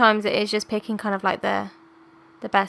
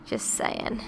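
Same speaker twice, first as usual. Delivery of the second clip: whispered aside; 11 semitones lower, 8 dB quieter than usual. Real, not acted. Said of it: "he is the only Irish person".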